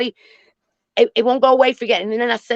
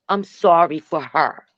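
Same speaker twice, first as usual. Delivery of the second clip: lower, slow, demonstrative "I'm sorry for her"; demo apology; 7 semitones lower, slower than usual. Shown as a demonstration. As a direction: show how easy a simple apology is. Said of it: "How easy was that?".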